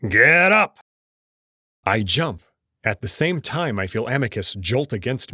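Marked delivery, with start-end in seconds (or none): 0.1-0.8 barked wake-up shout, loud and high; angry shout. Acted. Old wolf Kato roaring at the couple to get up. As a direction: startle them awake with a furious yell.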